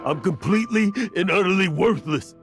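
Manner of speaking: high, loud burst of despair; anguished self-reproach. Acted. Old adventurer confesses he let so much slip away, anguished outburst. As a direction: break out in bitter self-loathing.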